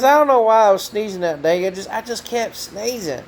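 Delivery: a hint of Ray's voice; imitating Ray. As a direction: play old Ray telling how he got his name, lightly. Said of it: "He goes".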